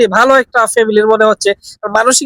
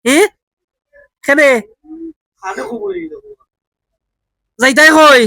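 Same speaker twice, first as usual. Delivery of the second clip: Sylheti Bengali questions, raised and patient; Sylheti dialect; 3 semitones higher than usual. Shown as a demonstration. To a confused old man, questioning him in Sylheti dialect. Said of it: "Sylheti ভাষা try করে দেখি".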